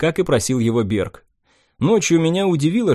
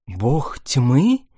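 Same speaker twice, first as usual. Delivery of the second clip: surprised mutter. Acted. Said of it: "удивлённо пробормотала Тиара".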